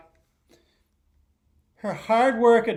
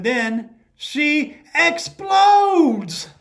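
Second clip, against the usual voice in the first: higher, louder, drawn-out explodes; dramatic slow outburst; 8 semitones higher, 8 dB louder, much slower than usual. A narrator's reading. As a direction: let explodes burst out slowly. Girl's anger bursts in story.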